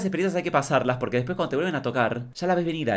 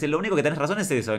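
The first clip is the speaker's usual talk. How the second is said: normal talking voice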